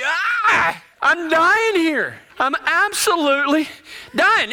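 high, drawn-out comic wail; mock near-tears. Cowboy hiding tears from his buddy, hammed-up desperate whine. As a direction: whine as if about to cry. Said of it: "starting to cry".

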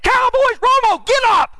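high shouted outburst at the screen; mock yell at the TV. Fan losing temper watching the Cowboys, shouting. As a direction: yell at the quarterback to get up. Yelling at TV football game.

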